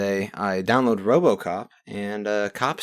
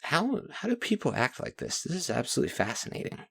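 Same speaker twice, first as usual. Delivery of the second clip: higher, softer, marvelling disbelief; quietly baffled, pitched up; incredulous quoted question; 6 semitones higher, 8 dB quieter than usual. Retold or imitated. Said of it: "I'm just like".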